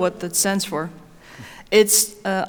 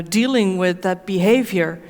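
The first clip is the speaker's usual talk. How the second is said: normal talking voice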